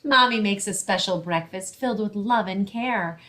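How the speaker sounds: ordinary delivery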